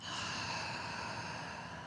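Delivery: soft, voiceless exhale; quiet breathy sigh; long sigh. Real, not acted. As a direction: let a long breath out slowly. Breathing during opening meditation.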